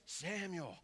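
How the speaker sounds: softly voiced call of a name. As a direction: lightly play a voice calling in the night. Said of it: "hearing his voice".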